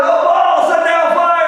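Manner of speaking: high, loud frenzied cry to Baal; frenzied yell. Retold or imitated. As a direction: cry out wildly to your god. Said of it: "and they're yelling".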